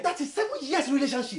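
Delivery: indignant protest. Acted. Uncle reminds husband of the long relationship, indignant protest. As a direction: stress how long it has been.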